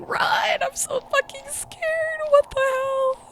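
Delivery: very high, panicked squeal; terrified squeal. Real, not acted. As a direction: shriek it out in genuine panic. Panicked reaction to horror chase.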